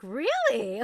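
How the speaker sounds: loud, high "oh really?"; mock-interested reply. Owner pretends conversation with her cat, loud high mock interest. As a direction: play along, hugely interested. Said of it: "and I'm like".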